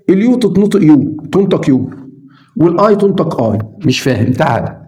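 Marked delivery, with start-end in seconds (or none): none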